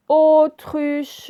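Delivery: "Autruche" high and loud, "Au" held long; stressed first syllable. Shown as a demonstration. Teacher showing O in first syllable, stretched and stressed. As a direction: lean hard on the opening O of "Autruche". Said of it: "je l'ai prononcé à la première syllabe".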